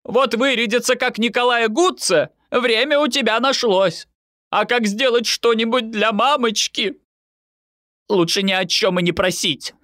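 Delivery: high-pitched sarcastic nagging; indignant guilt-tripping tirade; scolding mother. A narrator's reading. Mother scolding her son.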